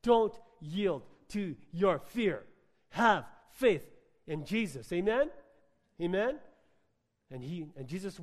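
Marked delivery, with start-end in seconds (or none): 0.0-4.9 slow, loud preaching emphasis; preached out slow and loud. Real, not acted. Pastor pressing the word on his congregation, slow and loud. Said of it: "God's saying that word to someone here today". 4.9-6.4 loud, high rising amen; rousing call. Real, not acted. Pastor calling the congregation to answer, loud rising call. Preacher calls congregation response.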